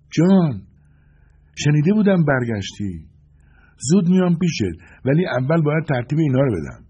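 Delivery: high, loud woman fighter's voice; Suzie's voice. A narrator's reading. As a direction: call out to an old acquaintance mid-fight, pitched high. Voicing Suzie mid-gunfight, greeting John. (صدای زیر و بلند زن جنگجو)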